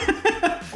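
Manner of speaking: high burst of laughter; real laughter. Real, not acted. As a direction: crack up at the botched impression. Laughs at botched impression.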